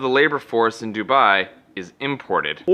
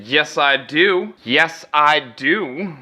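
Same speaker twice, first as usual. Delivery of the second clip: slow reply; 2 semitones higher, much slower than usual. Retold or imitated. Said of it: "And I said".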